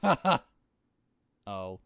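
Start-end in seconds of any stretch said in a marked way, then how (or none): 0.0-0.4 sharp, higher, louder mocking ha ha; short loud sneering laugh. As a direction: bark out a scornful laugh. Mocking laugh at grim moment.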